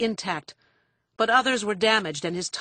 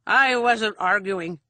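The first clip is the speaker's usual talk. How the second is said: slow, loud, peevish denial; cranky, argumentative; 3 semitones higher, 6 dB louder, slower than usual. A narrator's reading. Father contradicting his daughter, cranky and argumentative. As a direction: deny it crankily, dragging it out. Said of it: "he said in a cranky, argumentative tone".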